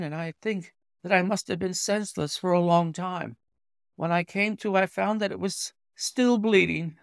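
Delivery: a hint of a pale young patient; lightly voiced young engineer. Visitor asking the doctor to treat his thumb, lightly voiced.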